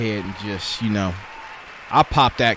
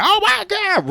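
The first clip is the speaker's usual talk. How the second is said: very high thrilled "oh my God"; imagined excited squeal. Retold or imitated. He imagines his fiancée's thrilled reaction, squealing high. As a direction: squeal it like she's overjoyed. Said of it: "just like".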